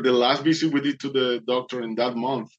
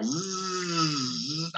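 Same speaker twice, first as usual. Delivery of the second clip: drill buzz; 6 semitones higher, 6 dB quieter than usual. Retold or imitated. Imitating the drill again.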